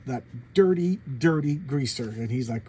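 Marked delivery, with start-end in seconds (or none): none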